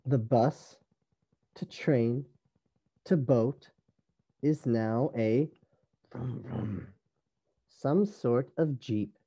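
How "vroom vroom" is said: engine imitation. Storyteller imitates the jeep, soft engine noise. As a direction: rev it softly like an engine.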